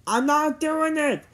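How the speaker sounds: high, slow protest; crying out. A narrator's reading. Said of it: "cried Jimmy".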